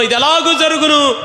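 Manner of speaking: high, ringing rhetorical question; emphatic quoted question. Acted. Preacher quoting the question "how will this be" to believers, high emphatic call. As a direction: pitch it right up, calling out the question. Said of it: "ఎందుకు ఇంత గట్టిగా చెప్పగలుగుతున్నాం".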